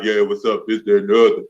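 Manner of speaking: put-on teen boy showing off his deep voice to his crush; deep boyfriend voice. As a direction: drop low into a deep, cool guy voice. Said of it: "did y'all hear how deep that went".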